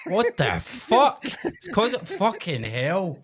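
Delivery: high, drawn-out disbelief; incredulous outburst. Real, not acted. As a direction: cry out, stunned at yourself. Shocked at his own botched accent.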